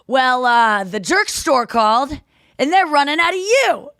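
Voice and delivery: loud, drawn-out punchline, rising high at the end; George's smug comeback. Retold or imitated. Delivering a long-planned comeback, hammed-up smugness. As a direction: land the big zinger, loud and savoured.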